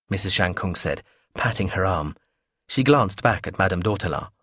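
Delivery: usual speaking voice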